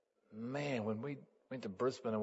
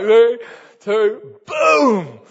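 high, loud countdown ending in boom; shouted countdown; 10 semitones higher, 19 dB louder, much slower than usual. Retold or imitated. Counting down the drop with the kids, loud and high. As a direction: shout the last numbers. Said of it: "I'm like".

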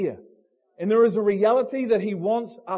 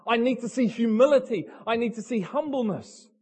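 usual speaking voice

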